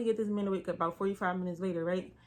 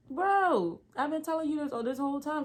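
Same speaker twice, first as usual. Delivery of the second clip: high, exasperated outburst; 8 semitones higher, 3 dB louder than usual. Retold or imitated. Assistant telling the doctor off, exasperated and high. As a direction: blurt it out, fed up.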